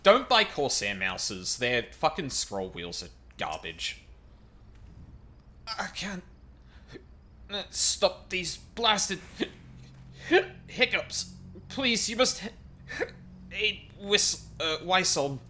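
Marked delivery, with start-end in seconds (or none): 5.7-15.4 high, slow voice broken by hiccups; high pleading hiccupy voice; hiccuping warrior. Acted. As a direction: plead for help between hiccups.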